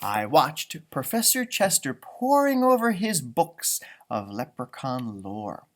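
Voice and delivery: plain reading voice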